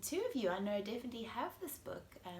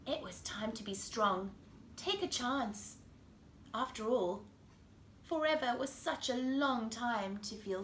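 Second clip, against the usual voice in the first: put-on lively storybook voice for kids; reading to children; 5 semitones higher, 11 dB louder than usual. A narrator's reading. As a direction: read it like bedtime story time. Reads picture book to children.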